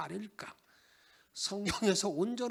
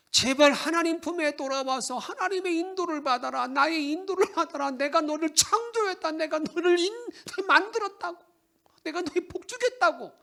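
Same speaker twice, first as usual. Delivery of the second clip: loud, high, urgent appeal; God's earnest plea; 9 semitones higher, 8 dB louder than usual. Acted. God calling the people back, loud and pleading. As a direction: call them home with rising urgency. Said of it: "그렇게 말합니다".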